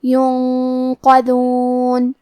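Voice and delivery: ینقذون with the ن hidden into a nasal hum before ق, very slow; slow ینقذون with nasal ikhfa; hidden nun demo. Shown as a demonstration. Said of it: "نون ساکن کو چھپاتے ہوئے اور غنہ کے ساتھ".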